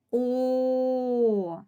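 О held long and steady; drawn-out О standing alone; sustained vowel О. Shown as a demonstration. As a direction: sing out a long О on one note. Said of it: "Перший склад".

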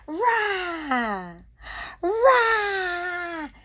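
high, drawn-out mock roar; loud playful "rah" held long. Playful monster roar at doll.

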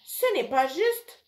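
put-on a kid crying unfair; protesting girl. A narrator's reading. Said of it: "proteste Georgia".

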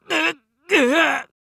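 high, slow grunts of effort; strained grunts. Acted. Pilot crushed by gravity, high slow strained grunts.

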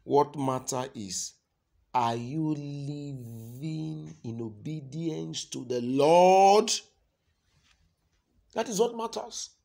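loud, high, drawn-out sermon delivery; full-force preaching. Real, not acted. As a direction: preach it loud, stretching the key words.

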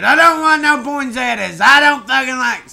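a half-hearted attempt at a heartfelt rant; straining to sound passionate; forced vehement outburst. Shown as a demonstration. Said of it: "I can't even say that with all the heart in my soul".

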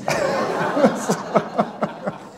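airy laugh trailing off; breathy laugh. Real, not acted. Pastor laughing at the subject never coming up, airy laugh. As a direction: laugh breathily and let it fade.

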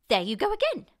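high, loud scolding outburst; cried out. A narrator's reading. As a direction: cry out in irritation. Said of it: "she cried".

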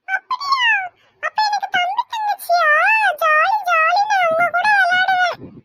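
put-on a little kid thrilled about a baby brother or sister; excited small child. Grandchild told a sibling is coming, high excited child voice.

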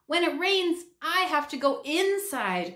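high, whiny little gripe; complaining. A narrator's reading. Myron griping to the hobo, whiny complaint. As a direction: grumble it like a put-upon kid. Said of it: "complained Myron".

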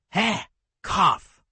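a sharp high laugh that breaks into a cough; laugh, then choke on the cough; pained laugh-cough. A narrator's reading. Injured husky scoffing at Anduin, laughing into a cough. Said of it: "too injured to laugh without coughing".

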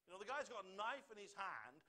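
ordinary delivery

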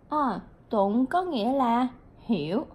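ordinary talk

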